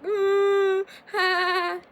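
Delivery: loud, high "ooh" then "ha"; exaggerated high mood-swing noises; mock emotional outburst. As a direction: act out mood swings with high, drawn-out wordless cries. Imitates mood swings on a period.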